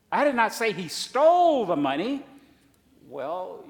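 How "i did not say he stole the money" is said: "stole" stretched, higher and louder; stress on STOLE. Shown as a demonstration. Preacher demonstrating the phrase, loud stress on STOLE. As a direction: hit "stole" hard. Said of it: "implying that perhaps he took it without permission".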